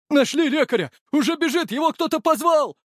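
very high, urgent report; excited shouting. A narrator's reading. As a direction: call out the news in relief. Urgent shouted news of medic. (взволнованный крик, очень высоко)

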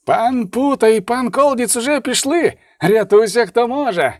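high, urgent shout; panicked yell. A narrator's reading. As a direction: yell the bad news while fleeing. Fleeing rider shouting news.

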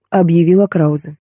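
usual speaking voice

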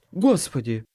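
lightly voiced young man exclaiming; a hint of a youthful tenor; short exclamation from a young lad. A narrator's reading. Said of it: "совсем ещё молодой тенорок".

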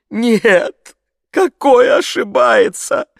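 high, loud, drawn-out wail; tearful voice. A narrator's reading. Maid answers the gentleman, tearfully wailing. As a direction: wail like a distraught peasant maid. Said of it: "заговорила слезливым голосом женщина".

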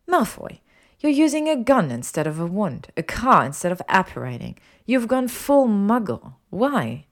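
high, loud confrontation; exasperated. A narrator's reading. Hermione confronts Malfoy over his muggle ways, exasperated.

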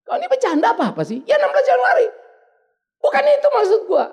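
loud, high exasperated outburst; almost shouting in frustration; retold anger at wife's questions; angrier. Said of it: "Makin marah lagi saya".

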